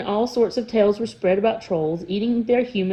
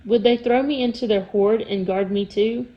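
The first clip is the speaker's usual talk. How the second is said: ordinary delivery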